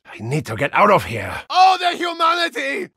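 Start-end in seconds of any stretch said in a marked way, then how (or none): 1.5-2.9 high, hammy outcry; dramatic wail. Acted. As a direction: overplay the despair. Mock dramatic lament.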